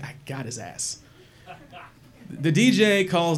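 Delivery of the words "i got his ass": much softer and lower, gloating to himself; under-breath gloat. Retold or imitated. Thinking smugly about his rival, low and quiet. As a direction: mutter it as a private victory. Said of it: "and I'm like".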